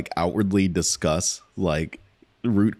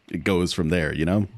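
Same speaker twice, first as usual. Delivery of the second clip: usual speaking voice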